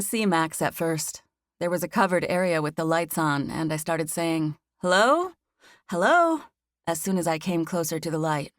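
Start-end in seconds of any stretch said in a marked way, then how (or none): 4.8-6.4 high, louder call into the dark. Acted. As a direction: call out nervously, searching for someone. Calling out in the dark.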